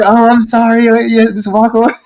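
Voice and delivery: hurried high-pitched mock apology; flustered apology. Guide apologizing to woman he hurt, flustered retold apology. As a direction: act out an embarrassed, fussing sorry. Acting out his panicked apology.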